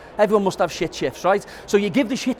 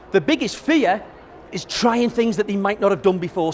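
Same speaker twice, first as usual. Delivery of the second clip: normal talking voice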